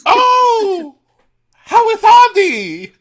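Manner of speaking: very high, loud cartoon toy; squeaky toy voice. Toy character greets them.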